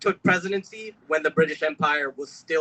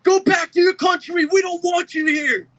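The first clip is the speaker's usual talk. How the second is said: much higher, louder hostile yell; retold yell; 12 semitones higher, 4 dB louder than usual. Young man yelling at his parents from a car, hostile yell. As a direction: throw the stranger's slur out like from a car window. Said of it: "yells at them".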